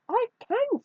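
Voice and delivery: very high mouse squeak; high little storybook voice; insistent mouse. A narrator's reading. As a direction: pipe up defiantly as a tiny mouse. Mouse answers the scornful kitten.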